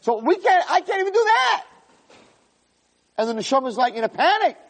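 very high, tight voice; soul in panic listing what is left, very high; high strained voice. Acted. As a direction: squeeze the words up high in panic. Possessions voice, then soul panic.

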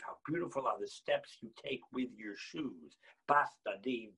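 ordinary talk, nothing marked

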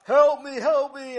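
high, loud cry for help; calling for help. Acted. Sammy stuck in the garbage can crying for help. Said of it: "he called out".

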